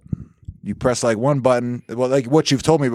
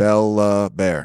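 drawn out; 2 semitones lower, much slower than usual. Retold or imitated. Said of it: "Way too many syllables".

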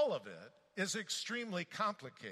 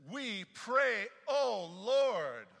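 crying out; 7 semitones higher, 8 dB louder, much slower than usual. A narrator's reading. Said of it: "they cried out to the Lord".

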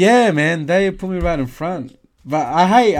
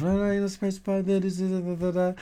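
mock Karen scolding; 4 semitones higher, 9 dB quieter, faster than usual. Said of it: "she was going off".

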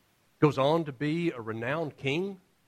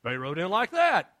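usual speaking voice

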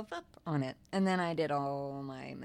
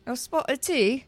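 loud, higher hammed-up British accent; mock British accent; 8 semitones higher, 8 dB louder than usual. Correcting her co-host, loud hammed-up British accent. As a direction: overdo the English lilt on A spot of tea?. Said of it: "That wasn't very good".

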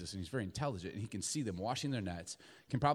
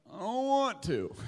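put-on higher, slow whining child; whiny kid; 9 semitones higher, 5 dB louder, slower than usual. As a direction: pout like a kid refusing chores. Child refusing a parent.

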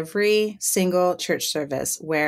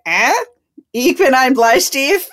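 higher, louder, questioning German with a rise; unsure repeat; 6 semitones higher, 6 dB louder than usual. Retold or imitated. Joker facing silent stares, repeating it questioningly. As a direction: try the joke on them as a slow question.

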